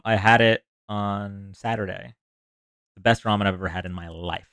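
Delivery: normal talking voice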